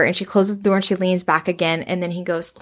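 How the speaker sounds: ordinary talk, nothing marked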